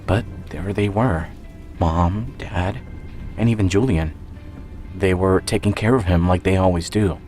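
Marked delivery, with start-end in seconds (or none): none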